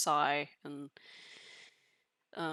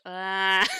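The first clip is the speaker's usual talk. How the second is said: high squealing laugh-cry; emotional laugh-cry; high teary laughing cry; 8 dB louder than usual. Real, not acted. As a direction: squeal with overwhelmed delight. Overwhelmed by cute bird.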